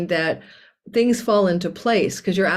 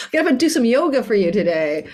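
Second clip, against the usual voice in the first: higher, laughing taunt; laughing defiance; 5 semitones higher than usual. Real, not acted. Astrologer joking back at critics of her moving, laughing defiance. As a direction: tease the critics through a laugh.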